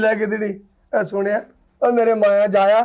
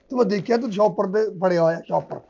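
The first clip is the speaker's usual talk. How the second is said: normal talking voice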